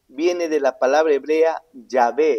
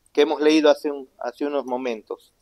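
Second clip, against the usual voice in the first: ordinary talk, nothing marked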